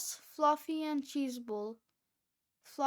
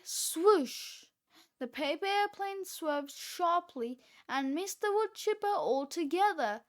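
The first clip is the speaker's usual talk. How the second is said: ordinary delivery, nothing marked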